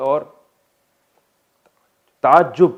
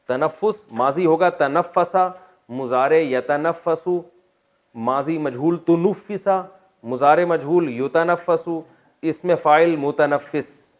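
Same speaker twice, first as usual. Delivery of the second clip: slow paradigm recital; 4 semitones higher, 4 dB louder, much slower than usual. Shown as a demonstration. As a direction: recite each form slowly and deliberately. Reciting verb forms slowly.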